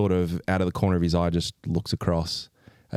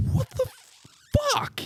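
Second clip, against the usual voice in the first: very high shocked what the; high falsetto shock; shocked squeal. Retold or imitated. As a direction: squeak it out in disbelief. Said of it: "I'm like".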